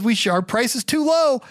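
lightly voicing an eager friend; excited. John rushing out of the room, mildly excited. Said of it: "He says".

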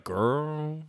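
put-on low, soft, drawn-out annoyed "girl"; unimpressed, dragged-out groan of a word. Shown as a demonstration. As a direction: let it sink low and long, fed up. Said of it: "you could be annoyed".

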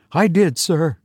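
high, deferential and puzzled; puzzled servant. A narrator's reading. Puzzled servant answering Tussman's question, high deferential voice. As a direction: raise the voice into a bemused servant's. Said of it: "answered with a puzzled look on his face".

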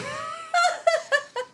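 bright high-pitched laugh. Real, not acted. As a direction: crack up at the joke. Laughing at own joke.

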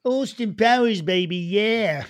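big cartoonish catchphrase delivery; Austin Powers. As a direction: belt it out as a groovy spy. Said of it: "other things like Austin Powers".

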